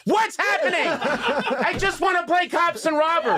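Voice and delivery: put-on a confused little boy pleading to just play; little brother protesting the made-up rules, high and whiny. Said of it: "he was like".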